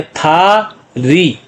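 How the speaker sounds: "था री" very slow, both vowels stretched; syllable by syllable. Shown as a demonstration. Teacher showing what the letters make, "था री" slowly separated. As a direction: hold "था", pause, then long "री". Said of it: "तो क्या बन गया".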